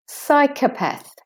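"Psychopath" as high SY, weak kuh, path; de-stressed O. Shown as a demonstration. Teacher modelling for learners, "Psychopath" with weak O. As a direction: lift SY in "Psychopath", reduce the O to schwa. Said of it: "Psych, the O".

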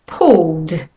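pulled, L straight into D, one syllable; pulled with a final d. Shown as a demonstration. Teacher models pulled for learners to copy. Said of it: "The first word is".

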